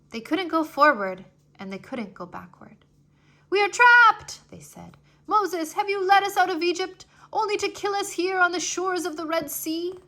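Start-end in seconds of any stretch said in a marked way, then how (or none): 3.5-4.4 much higher, loud cry of despair; trapped. A narrator's reading. Israelites cornered between sea and army, loud despairing cry. 5.3-10.0 much higher, loud desperate accusation; accusing panic. A narrator's reading. Israelites blaming Moses at the Red Sea shore, loud panicked accusation.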